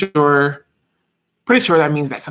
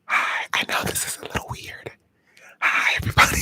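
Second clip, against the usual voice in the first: hushed ASMR-style whisper. Real, not acted. Greeting live viewers in an ASMR stream, whispering. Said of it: "because I'm whispering".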